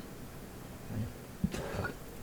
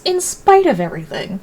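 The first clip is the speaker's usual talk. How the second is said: pointed reinterpretation; 7 semitones higher, 4 dB louder than usual. Retold or imitated. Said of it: "the real meaning of his".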